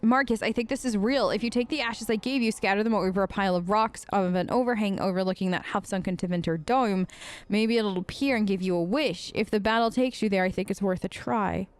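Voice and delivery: low, even read-aloud of a letter; reading a note. Acted. Player reading a scribbled note found on a body, read-aloud. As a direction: read the scribbled note as if it's the writer talking.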